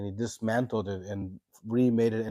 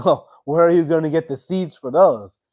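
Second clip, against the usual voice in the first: loud, higher-pitched mocking question; somewhat mocking; 7 semitones higher, 12 dB louder than usual. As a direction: tease with a raised, loud voice, acting out a scoffing god. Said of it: "Lord Indra somewhat mockingly said".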